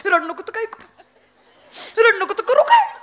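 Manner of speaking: hammed-up tearful complaint; whining wife. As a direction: whine back at the neighbour in mock despair. Crying wife answers back.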